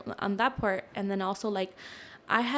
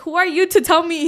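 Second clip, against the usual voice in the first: much higher and much louder, outraged; indignant outburst; 9 semitones higher, 14 dB louder than usual. Retold or imitated. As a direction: fire back in disbelief, offended. Indignant retort to the psychic.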